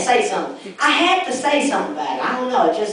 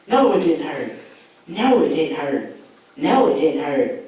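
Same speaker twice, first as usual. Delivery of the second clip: echoing in his head; 7 semitones lower than usual. Retold or imitated. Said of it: "that just ran through my head like nine times".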